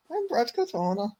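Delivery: high, strained mock of a mixed Canadian-Irish accent; Guru's Katona impression. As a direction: ham up a jumbled Canadian-Irish accent in a squeaky voice. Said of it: "M M A Guru do a fucking impression of Brad Katona".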